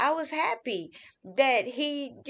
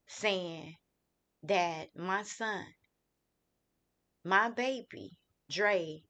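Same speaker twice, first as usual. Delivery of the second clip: low, soft and halting, with long pauses; hushed grief; 4 semitones lower, 6 dB quieter, slower than usual. Real, not acted. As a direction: break the news slowly, voice sinking away. Recalling news of son's death.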